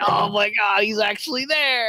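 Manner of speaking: high, excited exclamation; thrilled fan. Retold or imitated. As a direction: relive the amazement, pitch climbing. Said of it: "I'm like".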